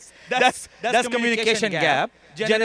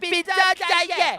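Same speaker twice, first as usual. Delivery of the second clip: higher, louder mock child voice; struggling Urdu-speaking son; 7 semitones higher, 3 dB louder, slower than usual. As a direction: exaggerate a son fumbling through Urdu. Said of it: "This is gonna sound very wrong".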